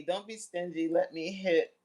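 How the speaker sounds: ordinary talk, nothing marked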